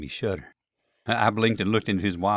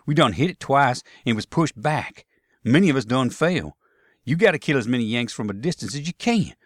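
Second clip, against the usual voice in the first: urgent Southern soldier; 5 semitones higher, 4 dB louder than usual. Acted. Tom urging Jeb to kill the officer, urgent. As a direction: press him hard to do his duty.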